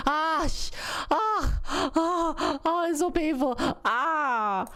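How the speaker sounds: high "ah" cries of pain; hammed-up labor moans; acted pain cries. Re-enacting her painful birth moment.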